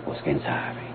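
hushed whisper so the stranger won't hear; whispering to a companion as a stranger nears. Acted. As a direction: drop to a secretive whisper. Stranger approaching, speaking secretly.

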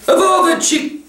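high, outraged character voice; indignant Beaver. A narrator's reading. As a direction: burst out indignantly, pitched high. Mister Beaver's outburst in story.

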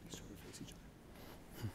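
hushed aside to the couple; whispering. Real, not acted. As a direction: whisper the instruction.

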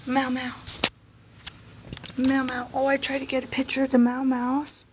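high, sing-song coo to the pets; pet-talk voice. Real, not acted. Owner cooing up high at chinchillas that keep running off.

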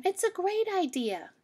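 put-on high, bright pleading kid voice; child rabbit backing up the plan; eager child. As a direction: insist like a hopeful little bunny.

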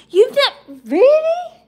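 really pitched very high and loud; shocked disbelief, voice leaps up; real surprise, very high and loud; surprised. Real, not acted. Reacting to Crystal being Irish.

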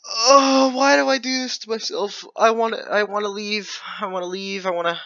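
high, pained moaning; high wail of despair. Real, not acted. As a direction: whine in defeat to the viewers. Despair after losing.

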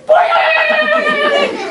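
very high, loud springy boing; boing sound effect. Retold or imitated. Storyteller voicing the frog's leap, springy boing. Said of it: "that bullfrog goes".

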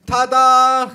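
high, very loud fanfare; triumphant mother. A narrator's reading. Mother unveiling the present, high loud triumph. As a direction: sing it out as a big reveal. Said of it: "rief meine Mutter".

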